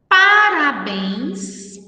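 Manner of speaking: "parabéns" slowly, syllable by syllable. Shown as a demonstration. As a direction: sound out "parabéns" in held syllables. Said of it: "na separação de sílabas".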